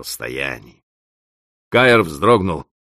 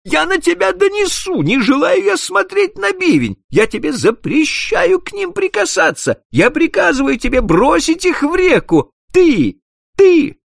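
shrill, very high, loud screaming; a boss screaming threats at his clerk; hysterical rage; 5 dB louder than usual. Acted. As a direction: scream each order, losing control.

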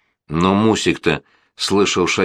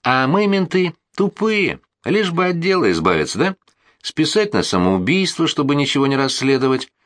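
sarcastic colonel; 7 semitones higher than usual. Acted. Colonel's sarcastic mock-offended reply.

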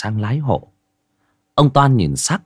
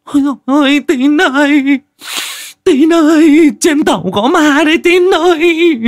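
much higher, much louder screaming woman; terrified shriek; 8 dB louder than usual. Acted. Wife waking up screaming to Tín about a ghost, terrified. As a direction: shriek for her husband in panic. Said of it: "cũng tỉnh dậy mà rú lên".

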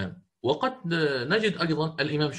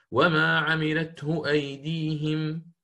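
Hafs recitation; 3 semitones higher, much slower than usual. Shown as a demonstration. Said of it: "في قوله تعالى".

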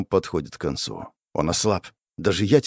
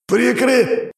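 a much higher strained call broken off; trying to warn a partner as he's struck; desperate cry, cut off; 14 semitones higher than usual. A narrator's reading. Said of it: "пытаюсь сказать напарнику".

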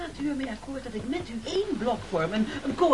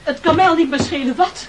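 impatient outburst; 5 semitones higher, 9 dB louder than usual. Acted. Hungry man snaps impatiently.

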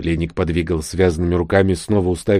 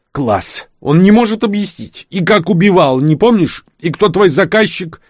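much higher, louder sarcastic yell; mocking angry outburst; 10 semitones higher, 5 dB louder than usual. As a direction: throw his words back at him in fury. Vlada mocks the lying prisoner.

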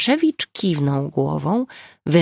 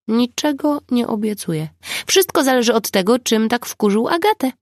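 higher-pitched character voice; Nina's teasing defiance; 8 semitones higher, 3 dB louder than usual. A narrator's reading. Nina refusing to promise restraint, in a high voice. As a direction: answer the scolding in a raised, lively pitch.

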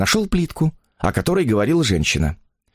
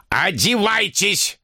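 high, drawn-out command; insistent old man's demand; stern order; 10 semitones higher, much slower than usual. A narrator's reading. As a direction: order her sharply, stretching the word. Old man orders sick woman.

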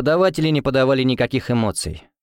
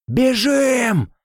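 yell; 13 semitones higher than usual. A narrator's reading. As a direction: scream at everyone to run. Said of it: "заорал Финн".

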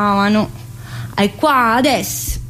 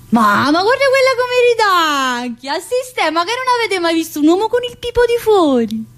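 mock indignant; 7 semitones higher than usual. Mocking a giggling classmate.

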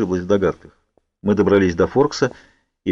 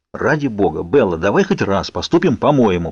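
usual speaking voice

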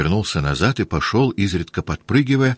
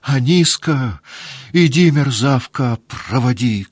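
angry yell; 7 semitones higher, slower than usual. A narrator's reading. Mistress yells at the maid to lead the way. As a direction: bark orders at the maid. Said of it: "крикнула она на девку".

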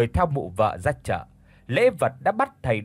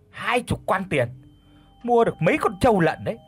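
shocked exclaim; 3 semitones higher, faster than usual. Acted. Husband shocked at the tutor's fee, high fast indignant outburst. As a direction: sputter in disbelief over the money. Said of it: "thì thốt lên".

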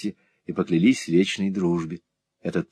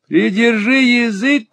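high, slow piercing screech; jealous girl shrieking at her friend to shut up; shrill shriek; 14 semitones higher, 5 dB louder, slower than usual. A narrator's reading. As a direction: shriek it at the top of your voice. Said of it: "взвизгнула мисс Сквирс самым пронзительным голосом".

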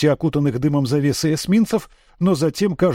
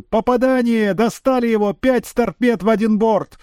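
sports commentator shout; 7 semitones higher than usual. A narrator's reading. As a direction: call it like a live sportscaster. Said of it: "голосом спортивного комментатора выкрикнула".

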